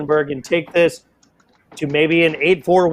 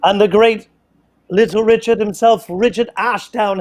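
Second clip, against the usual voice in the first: put-on terrible English accent; 4 semitones higher than usual. Commentator welcoming darts legend Richard Ashdown, in a terrible mock English accent. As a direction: announce the guest in a bad mock English accent. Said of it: "I do my terrible English accent".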